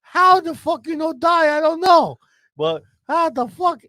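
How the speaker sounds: put-on high, loud, slow Spanish-accented yelling; incredulous Spanish neighbour. Neighbour amazed the faller is alive, high loud disbelief. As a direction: yell it way up high as the astonished neighbour. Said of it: "He's like".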